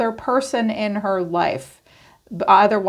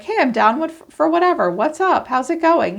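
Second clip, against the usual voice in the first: breezy, higher-pitched casual greeting; light and playful, pitched up; lighthearted; 8 semitones higher than usual. Acted. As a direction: act out an easygoing, up-for-anything hello. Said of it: "you're very lighthearted".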